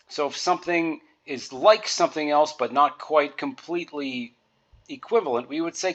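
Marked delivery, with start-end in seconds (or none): none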